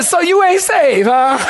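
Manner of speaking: high, laughing jab; teasing. Retold or imitated. Husband needles his wife on the way home, teasing.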